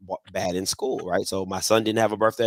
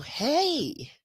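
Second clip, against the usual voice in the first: put-on much higher, slow, syrupy talk-to-a-child voice; much slower than usual. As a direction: coo it like a lady talking to a toddler.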